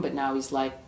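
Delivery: plain reading voice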